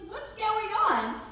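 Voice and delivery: put-on high, loud, drawn-out child's question; baffled son. Son questions mom's calm act, high imitated child. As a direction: imitate a kid baffled by mom. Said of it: "He's like".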